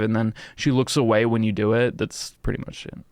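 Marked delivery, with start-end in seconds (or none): 2.0-3.0 quick whispered throwaway; whispered aside. Real, not acted. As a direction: drop to a whisper, to yourself. Muttered aside after explanation.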